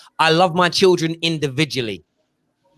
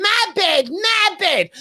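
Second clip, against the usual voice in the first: put-on much higher whiny little girl; dad imitating his toddler's whine, much higher; 13 semitones higher, much slower than usual. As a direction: play the daughter wanting her bed. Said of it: "She's going".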